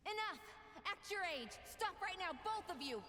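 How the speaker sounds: high, urgent yell at two men; shouting to stop a fight. Acted. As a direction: cut them off, demanding they stop. Stopping a standoff.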